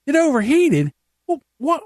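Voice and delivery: high disbelieving repeat; incredulous dad. Retold or imitated. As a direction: echo it back in disbelief. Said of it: "He goes".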